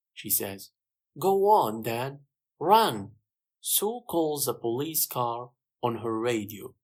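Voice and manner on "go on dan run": loud, raised-pitch urging; pressing, called-out order; urgent urging. A narrator's reading. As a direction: send him off with a call. Sue urges Dan to chase.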